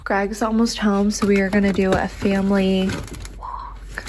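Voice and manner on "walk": hushed whisper so nobody hears. Real, not acted. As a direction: whisper it, someone's close by. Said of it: "I have to whisper".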